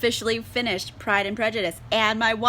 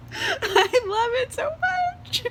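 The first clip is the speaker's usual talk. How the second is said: much higher, slow, crying laugh that breaks into a cry; laughing through tears; much slower than usual. Real, not acted. Reviewer overwhelmed by Darcy's devotion, real laughing cry. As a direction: let the joy spill over into laughing sobs. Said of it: "I'm just gonna cry".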